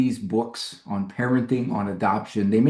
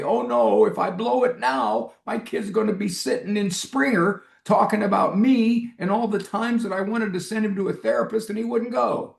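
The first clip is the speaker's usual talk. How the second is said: mock panic; 8 semitones higher than usual. Imagined panicky parent.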